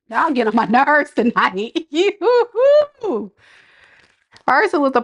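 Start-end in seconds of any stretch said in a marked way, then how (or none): 1.9-3.3 high exasperated laugh. Real, not acted. To the viewers who get on her nerves, a high exasperated laugh. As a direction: let out a high laugh of disbelief.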